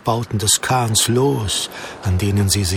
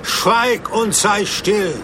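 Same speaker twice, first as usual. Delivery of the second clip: slow, higher commanding call; weighty order; mighty slow command; 8 semitones higher, much slower than usual. Acted. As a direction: rebuke the storm with authority. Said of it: "rief er mit gewaltiger Stimme".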